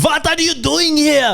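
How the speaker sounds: put-on high, drawn-out German-accented yell; mock an angry German border officer's shout. Guessing how the German officer yelled, mock German-accented yell. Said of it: "like, yelling in German".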